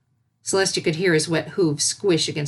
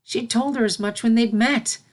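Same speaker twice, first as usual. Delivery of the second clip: usual speaking voice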